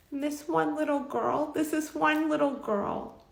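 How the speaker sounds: high, tender, choked-up retelling; emotional. Retold or imitated. Employee tells boss about a child she cares for, emotional. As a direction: pitch climbing as emotion rises. Retold tearful worry about the kids.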